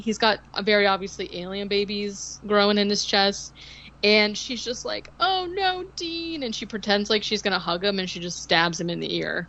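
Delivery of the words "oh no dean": high, cooing pretend dismay; put-on tender "poor you" lilt; mock concern. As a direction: fake sympathy up in a high voice. Acting Kim's fake concern.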